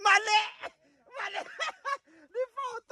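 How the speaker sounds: high voice breaking into laughter; laughing. Real, not acted. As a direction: laugh through the words. Laughing at own wrong lyric.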